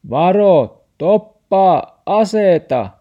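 loud, high yell; warning shout. A narrator's reading. Grandfather yells at Max to stop, loud shout. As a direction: bellow the warning at full volume. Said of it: "Murfaari huusi".

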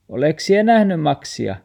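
grandma calling out, loud and high; asking after the dog. Acted. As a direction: call out worried for Max. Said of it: "Murfaari kysyy taksilta".

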